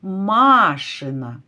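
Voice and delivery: машина with a lengthened first А; stress on first syllable. Shown as a demonstration. Teacher looks for the stress in машина, stretching first А. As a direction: lean slowly on the opening МА of машина. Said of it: "Пошукаємо наголос у слові".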